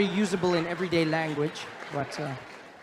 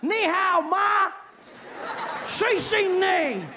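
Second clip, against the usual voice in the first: high, loud mock Chinese shout; 6 dB louder, much slower than usual. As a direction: shout fake Chinese, hammed-up. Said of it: "I see people say".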